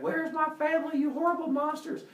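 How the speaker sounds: high, loud mock-furious demand; threatening. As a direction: shout a threat at monsters, hammed up. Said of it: "immediately starts threatening them".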